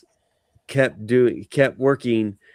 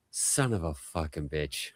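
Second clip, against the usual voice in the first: low, soft curse under his breath; muttered to himself; 10 semitones lower, 11 dB quieter than usual. Retold or imitated. Conan cursing quietly to himself in the meeting. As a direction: grumble it quietly to yourself. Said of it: "just going to himself like".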